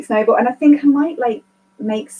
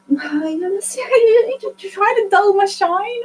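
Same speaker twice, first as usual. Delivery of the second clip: mocking accusation; 9 semitones higher, much slower than usual. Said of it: "it's also because".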